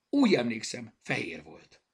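loud burst, falling on the last words; triumphant cry. A narrator's reading. Zorka proudly recalls the bear's colour, triumphant cry. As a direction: announce it loudly, in triumph. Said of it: "kiáltotta diadallal".

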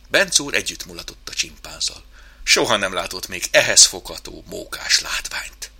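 plain reading voice

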